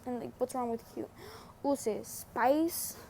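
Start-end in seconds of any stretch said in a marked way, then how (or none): none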